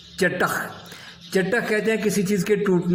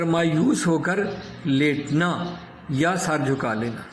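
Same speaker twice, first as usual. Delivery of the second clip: ordinary talk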